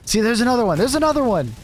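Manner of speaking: high, delighted spotting; playful glee; excited. Real, not acted. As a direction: point out another one gleefully. Spotting another Aussie in chat.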